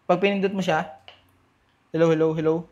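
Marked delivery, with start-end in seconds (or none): none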